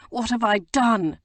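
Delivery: first cry of regret; remorseful. A narrator's reading. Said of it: "he said remorsefully".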